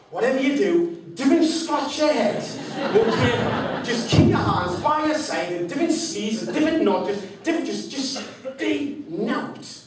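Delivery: loud, high, laughing warning from dad; dad hammers the rules into his son; urgent warning. Retold or imitated. Dad warning his son at the auction, loud and laughing.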